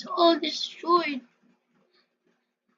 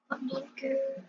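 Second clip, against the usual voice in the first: normal talking voice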